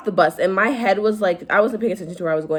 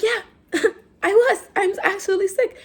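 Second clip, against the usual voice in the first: put-on whiny kid voice; 12 semitones higher than usual. Own childhood reply voiced.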